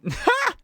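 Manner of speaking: sharp high cackle of a heckler; mocking high laugh. A narrator's reading. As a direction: laugh at her misfortune.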